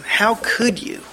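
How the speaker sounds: acted heartbroken reproach. Heartbroken wife confronting an unfaithful husband, acted reproach. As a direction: lean on COULD, high then falling. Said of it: "a wife that's heartbroken over an unfaithful husband".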